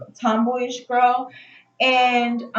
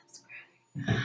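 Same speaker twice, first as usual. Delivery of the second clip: whispered plug to subscribe; 16 dB quieter than usual. Real, not acted. Vlogger whispering a quick plug to her viewers. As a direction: breathe it like a secret.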